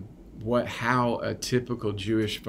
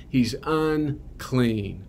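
usual speaking voice

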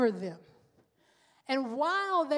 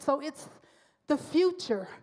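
ordinary talk, nothing marked

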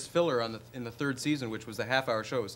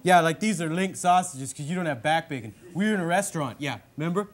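Bob McKenzie hoser voice; 4 semitones higher, 5 dB louder than usual. Acted. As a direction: play the dopey Canadian brother hosting his bit. In character.

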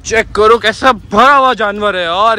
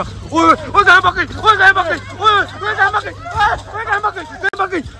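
high frantic yelling after a runaway cow; calling out. Real, not acted. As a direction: shout the alarm with the pitch way up. Cow escapes, he calls out.

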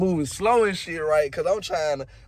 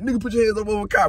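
rushed order; 3 semitones higher, much faster than usual. Retold or imitated.

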